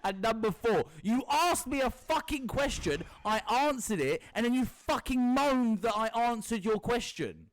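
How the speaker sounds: exasperated rant. Real, not acted. Telling his cohost off for moaning, exasperated and loud. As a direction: lay it on him, fed up. Exasperated rebuttal, point four.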